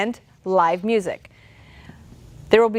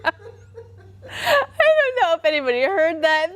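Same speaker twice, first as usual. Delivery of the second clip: giggling while speaking, higher; trying to talk through giggles; laughing; 6 semitones higher than usual. Real, not acted. Host giggling about her cohost's noise, laughing. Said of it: "laughing at a noise".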